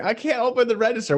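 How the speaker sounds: high, whiny barista voice; helpless barista. Retold or imitated. Friend retelling the barista's excuse to the host, high and whiny. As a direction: mimic her helpless whine. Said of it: "she was like".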